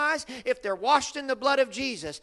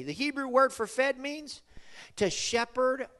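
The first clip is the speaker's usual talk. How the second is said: usual speaking voice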